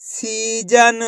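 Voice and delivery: "सीजन" very slowly as one word; slow teaching read of "सीजन"; blended, drawn-out word. Shown as a demonstration. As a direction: stretch each syllable of "सीजन", linked. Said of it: "तो मिलके बन जाएगा".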